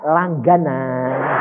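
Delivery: long drawn-out single word; teacher's weary refrain. Retold or imitated. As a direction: stretch it out like a teacher's regular refrain. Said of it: "Gurunya selalu bilang apa?". (ucapan guru yang dipanjang-panjangkan)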